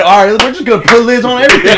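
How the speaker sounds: high, loud, fast mock rant; imitating Willie. Coworker mimics Willie ranting in the walk-in, fast and loud. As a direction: rattle it off like fed-up Willie. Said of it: "He said".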